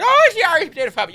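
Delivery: high, loud, gabbled mock-Irish accent; silly Irish parody. People making fun of the Irish, silly loud Irish accent. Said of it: "making it being very silly".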